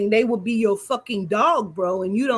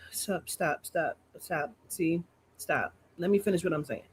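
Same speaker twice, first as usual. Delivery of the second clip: low, hushed interjection; quiet aside; 5 semitones lower, 9 dB quieter than usual. Real, not acted. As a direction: drop the voice to shush an interruption. Aside hushing an interruption while talking.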